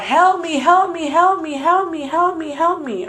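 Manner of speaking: high, sing-song mock scream, drawn out; imitated screaming for help. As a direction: act out repeated cries for help without full volume. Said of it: "I'm screaming out".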